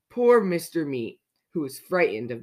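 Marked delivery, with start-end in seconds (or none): none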